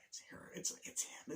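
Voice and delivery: very soft, hushed whisper; acted secretive whisper; whispered aside. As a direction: whisper so the caller can't hear. Covert aside during retold phone call.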